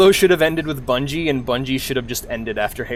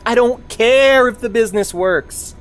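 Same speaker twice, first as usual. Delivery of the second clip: heated retort; 10 semitones higher, 4 dB louder than usual. Retold or imitated. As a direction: push back hard on the business guys. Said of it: "And I'm like".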